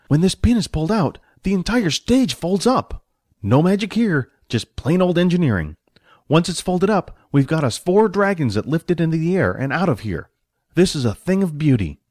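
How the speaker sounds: high-pitched manager showing off his engineering; proud stage manager. Acted. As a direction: boast about your invention in a lifted voice. Manager boasts of folding stage.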